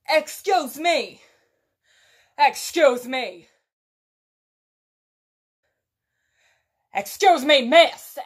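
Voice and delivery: loud, insistent calls across a store; a customer yelling excuse me from the front of the store; calling out loudly. Retold or imitated. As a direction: shout for attention from far away.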